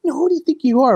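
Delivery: high, loud mock challenge; imitating a menacing look. Storyteller imitating New Jack sizing up the kid, mock challenge, high and loud. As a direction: voice the glare as a jab. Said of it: "he just looked at him like".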